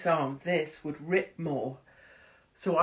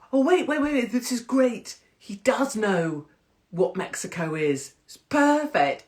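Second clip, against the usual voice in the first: higher, louder burst of retold relief; excited relief; 7 semitones higher, 6 dB louder than usual. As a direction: cut in excitedly, as if it suddenly dawns on you. Acting out her relieved realisation.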